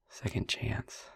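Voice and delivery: a hushed, intimate near-whisper; soft whisper. Acted. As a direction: keep it close and hushed, opening up. Tender confession continues.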